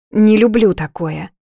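blurted a touch too loud; embarrassed, overly loud reply; a bit too loud. A narrator's reading. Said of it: "говорю чуть громче, чем надо".

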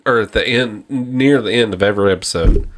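ordinary talk, nothing marked

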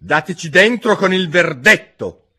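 a king pushing his jury; stern king. Acted. King urging the jury, booming.

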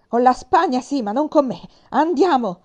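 loud, high-pitched cry of defiance; defiant shout. Acted. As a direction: shout down the bishop. (grido di sfida, forte e acuto)

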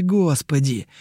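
ordinary delivery, nothing marked

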